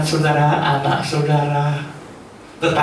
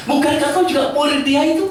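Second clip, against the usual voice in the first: put-on accusing servant girl; 10 semitones higher than usual. Slave girl questions Peter again.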